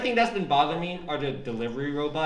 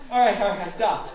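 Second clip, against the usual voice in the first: ordinary delivery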